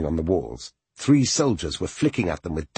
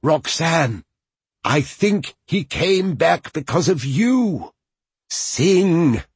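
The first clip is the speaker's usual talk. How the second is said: higher, slow, wavering but firm call; hesitant yet firm; 10 semitones higher, 4 dB louder, slower than usual. Acted. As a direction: urge her gently but firmly. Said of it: "hesitant and firm at the same time".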